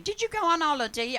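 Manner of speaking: high, fast hairdresser mimicry; chirpy high quick question; high quick hairdresser impression; hairdresser's voice. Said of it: "'Cause she talks like that".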